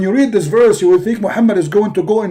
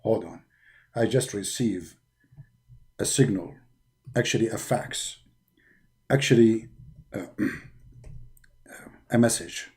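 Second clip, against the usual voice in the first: Jibreel's voice; 8 dB quieter than usual. Acted. Jibreel relays message.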